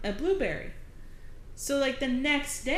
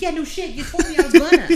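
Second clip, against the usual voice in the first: mock exasperated retort; 7 semitones higher, 13 dB louder than usual. Retorting to husband.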